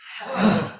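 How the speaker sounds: loud, forceful exhaled huh; powerful huh. Shown as a demonstration. Leader exercising the group's voices, forceful huh. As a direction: push the breath out in one burst. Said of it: "with a powerful".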